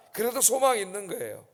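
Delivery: ordinary talk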